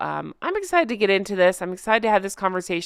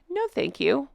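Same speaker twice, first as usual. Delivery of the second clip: softer, higher quoted polite refusal; 5 semitones higher, 5 dB quieter than usual. Retold or imitated. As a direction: lightly imitate a courteous turn-down.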